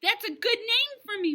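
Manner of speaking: high, loud delighted burst; light up with a new idea; real excited. A narrator's reading. Girl finding her clown name, excited. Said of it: "I clapped real excited".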